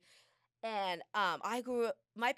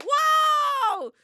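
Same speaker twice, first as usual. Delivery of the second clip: much higher, louder hammed-up cheer, slow on the end; mock cheerleader; 13 dB louder, much slower than usual. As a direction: cheer like a fake hype coach. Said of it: "am I supposed to be like".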